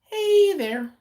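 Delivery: high, loud, stretched greeting; Rodney's catchphrase. Retold or imitated. Recalling a retired coworker's greeting, high loud imitation. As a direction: ring it out high and loud on a held note. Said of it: "he used to be like".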